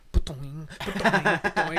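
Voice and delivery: loud cartoon "ba-doing"; imitated bin clangs. Storyteller imitates stick hitting the bin, loud boings. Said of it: "but I just heard".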